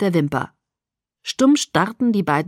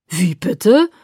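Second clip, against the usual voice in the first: loud, indignant disbelief; 6 dB louder than usual. A narrator's reading. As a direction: sound outraged. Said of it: "fragte Emmett entrüstet".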